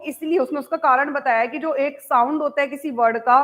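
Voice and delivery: ordinary delivery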